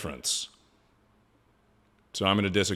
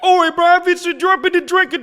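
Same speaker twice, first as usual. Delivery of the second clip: squeaky mock British accent, far higher and louder; a hammed-up British voice pitched way up; imitating British gals; 10 dB louder than usual. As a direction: parody the British girls with a high, loud fake accent. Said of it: "British gals ... and they're like".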